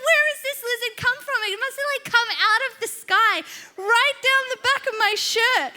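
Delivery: high, loud, fast panicked rant; hammed-up hysterics at high pitch; freaking out. Woman demands where the lizard came from, acted panic. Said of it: "I so start freaking out".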